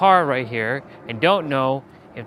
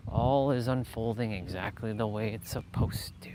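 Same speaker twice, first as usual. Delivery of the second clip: whisper; 3 semitones lower, 6 dB quieter than usual. Real, not acted. Calming self-talk.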